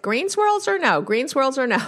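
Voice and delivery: high, sing-song mock call; imitating a wife pestering her husband; hammed-up green swirls question; retold nagging question. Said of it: "she's going back and forth".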